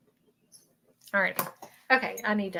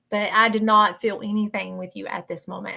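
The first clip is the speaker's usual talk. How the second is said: ordinary delivery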